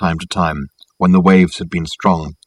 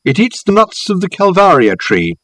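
higher-pitched call across to someone; 10 semitones higher, 4 dB louder than usual. A narrator's reading. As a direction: call it out to a companion some way off. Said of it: "called out Reg to Richard".

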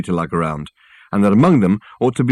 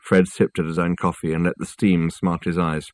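ordinary delivery, nothing marked

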